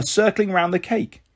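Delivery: ordinary delivery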